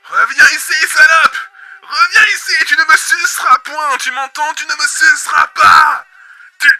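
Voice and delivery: high, loud enraged yelling; furious screaming. Acted. Host chasing the insect, screaming abuse. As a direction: scream after it with all you have.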